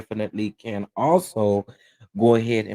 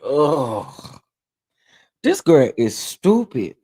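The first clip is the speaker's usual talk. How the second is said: slow, louder contemptuous verdict; disgusted aside; 6 dB louder, much slower than usual. Retold or imitated. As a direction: drag it out with scorn. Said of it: "Girl, I was like".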